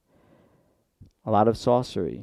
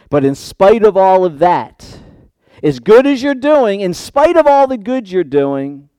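loud, high sermon build-up; impassioned preaching; 9 semitones higher, 9 dB louder than usual. Real, not acted. Preacher setting up Christ's rebuke after praise, impassioned preaching. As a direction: raise the voice to press the contrast.

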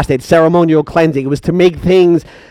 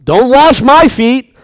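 higher, slow protest; protesting Peter; 6 semitones higher, slower than usual. Acted. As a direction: object like startled Peter.

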